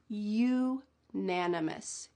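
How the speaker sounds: unanimous said slowly, starting with a clear you sound; teacher slowing unanimous; slow careful word. Shown as a demonstration. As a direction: open unanimous with a distinct you. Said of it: "the first sound of that second word".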